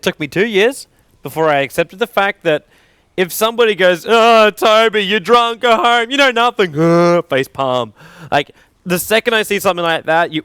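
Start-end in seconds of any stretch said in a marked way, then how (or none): 4.1-6.8 raised, higher-pitched heckle; dismissive mock scolding, pitched up; mock jeering. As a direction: jeer like a fed-up online heckler. Acted jeer from online hecklers.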